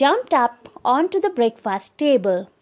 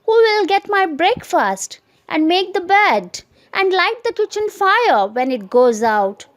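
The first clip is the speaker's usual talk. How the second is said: higher, fretful questions; alarmed; 7 semitones higher, 3 dB louder than usual. Acted. Husband lists chores he fears, fretful voice. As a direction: fret about every chore. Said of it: "quite alarmed at being left".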